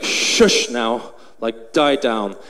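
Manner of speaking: low, stern, contained command. Retold or imitated. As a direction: quietly order it down, sternly. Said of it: "with a stern voice".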